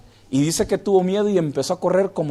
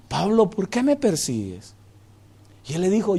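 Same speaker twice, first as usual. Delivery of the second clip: softer, hushed divine voice; quiet words of Jesus; 3 semitones higher, 5 dB quieter than usual. Acted. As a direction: let it drop to a solemn hush. Quoting Jesus to Paul quietly.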